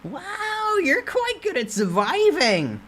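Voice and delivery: squeaky merchant sprite teasing the player. Acted. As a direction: sell it like a sly carnival barker. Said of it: "the Doc Aebi's merchant-like voice".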